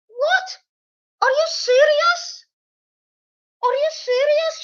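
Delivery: very high, drawn-out imitation of disbelief; mock outraged squeal. Mimicking the woman's reply to an accusation, high mock outrage. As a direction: squeak it in exaggerated indignation.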